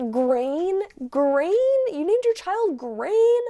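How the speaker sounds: rising, squeaky outrage; incredulous disbelief. Real, not acted. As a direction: question it as if utterly appalled. Aghast at the child's name.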